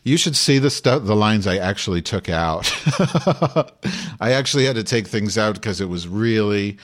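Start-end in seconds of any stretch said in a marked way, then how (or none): 2.7-4.2 high cackling burst of laughter. Real, not acted. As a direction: laugh at your own naughtiness. Laughs at own cut lines.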